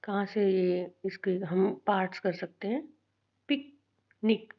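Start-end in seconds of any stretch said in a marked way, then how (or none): 3.5-4.5 picnic as short पिक, long pause, short निक; two short beats in picnic, far apart; split into syllables. Shown as a demonstration. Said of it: "short sound आ रही है".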